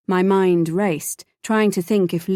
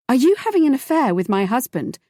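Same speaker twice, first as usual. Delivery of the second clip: blurted out; 6 semitones higher than usual. A narrator's reading. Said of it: "I blurted out".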